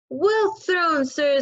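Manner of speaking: high, loud cheering call; shouted. Acted. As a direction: cheer across the playground. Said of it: "someone shouted".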